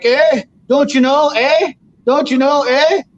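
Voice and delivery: high, slow mock Canadian "don't you know, eh"; mock Canadian accent. Teasing the Canadians who kept him awake, mock Canadian accent. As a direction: ham up a Canadian drawl. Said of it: "you hear their accent all night long".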